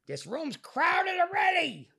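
put-on high, slow old man's complaint; imitating a cranky old man. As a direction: whine it like a stubborn old man. Old roommate voiced in story.

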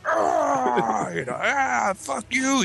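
high, hammed-up angry cry; dramatic teen outburst. Teenage son after the beating, a hammed-up angry outburst. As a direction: play the sulking teenager who punched the dresser. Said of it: "dramatic and shit".

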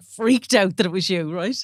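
ordinary talk

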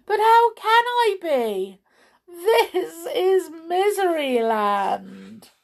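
high, loud despairing wail; crying out. A narrator's reading. King despairs to the visitor, crying. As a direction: wail it, dragging out the end. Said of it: "cried the king".

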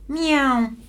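sudden high meow; high cat meow. Acted. Cat meows mid-speech.

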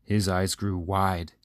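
ordinary delivery, nothing marked

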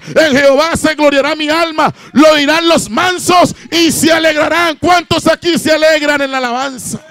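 loud, high-pitched preacher's shout; belted-out Pentecostal exhortation; full-force preaching. Acted. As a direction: cry out the praise to the whole congregation at full volume.

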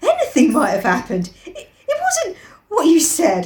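excited protest, pitch climbing; excitedly. Acted. Piggy defending what happened to Ralph, excited. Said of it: "said Piggy excitedly".

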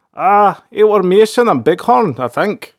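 high, loud voice of a hapless underling; dim-witted soldier. A narrator's reading. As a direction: pipe up as a witless soldier reporting. Soldier answers angry leader.

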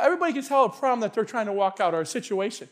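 normal talking voice

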